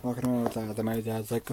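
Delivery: ordinary talk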